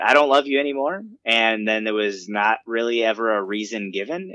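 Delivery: normal talking voice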